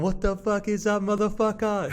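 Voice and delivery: mock yelled greeting. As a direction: shout it like greeting your best mate. Retelling the prank greeting.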